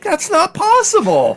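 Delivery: very high, slow, stretched disbelief; incredulous. Retold or imitated. Dad refusing to believe the plane hit the roof, slow and high. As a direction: drag it out in utter disbelief.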